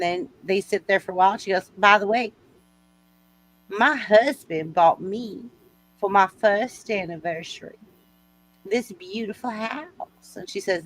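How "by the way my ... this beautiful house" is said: slow Southern belle drawl; Southern lady showing off, with long pauses; Southern accent. Acted. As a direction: brag genteelly in a Southern drawl. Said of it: "I like your Southern accent".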